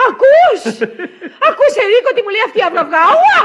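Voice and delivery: very high, loud indignation; outraged shout. Acted. Wife complains to husband about the maid, shrill outrage. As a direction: shriek your outrage at top volume.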